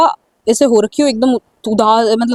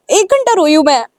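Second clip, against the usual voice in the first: normal talking voice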